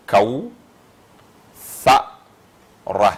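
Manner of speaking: kaou, fa, ra as separate syllables with pauses. Shown as a demonstration. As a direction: sound out kaou, then a loud fa, then ra. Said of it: "on a trois syllabes".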